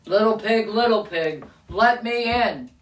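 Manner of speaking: wolf calling at the door, drawing out the last words; measured, menacing-play storybook wolf. Acted. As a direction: coax slowly like the Big Bad Wolf. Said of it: "and a voice says".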